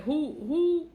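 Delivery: who shot up high and loud; incredulous who. Real, not acted. Daughter doubting her mother's court story, incredulous. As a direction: fling the who out in disbelief. Disbelief at claim about court.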